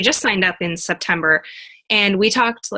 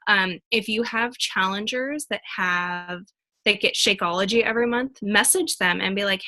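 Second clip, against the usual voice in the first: usual speaking voice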